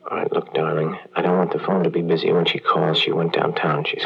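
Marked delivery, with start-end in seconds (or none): none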